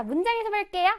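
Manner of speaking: usual speaking voice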